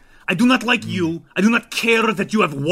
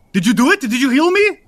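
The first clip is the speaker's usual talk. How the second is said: bewildered vampire; 8 semitones higher, 5 dB louder than usual. Acted. Ivan asks after failed healing.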